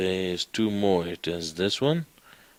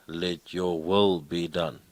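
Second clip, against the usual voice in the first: usual speaking voice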